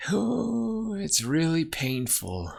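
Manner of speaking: drawn-out high "oh", then slow; acted pain. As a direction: play a mentor easing through pain, slowly. Acting mentor in pain.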